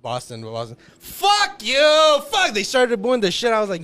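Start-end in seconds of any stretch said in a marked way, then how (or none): 1.0-2.5 high, loud jeering yell; rowdy fan shout; crowd shout. Retold or imitated. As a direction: boo him down like a crowd. Boston crowd booing celebrity.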